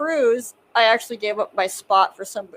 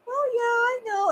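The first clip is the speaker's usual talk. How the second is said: mock aunt voice; 13 semitones higher, 4 dB quieter than usual. Aunt voiced in retold story.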